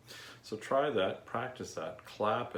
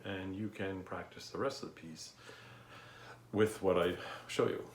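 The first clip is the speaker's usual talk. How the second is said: usual speaking voice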